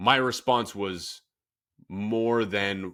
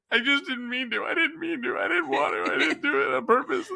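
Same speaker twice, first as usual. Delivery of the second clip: put-on much higher, whiny child's sob-talk; high, wailing little-kid plea; childlike crying plea. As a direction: plead like a crying kid who broke something. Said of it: "I was just like".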